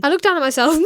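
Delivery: usual speaking voice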